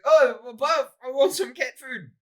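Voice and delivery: high, hammed-up South African accent; District Nine cat food guy. Podcaster acting out a film character, exaggerated South African accent. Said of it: "impression of the cat food guy".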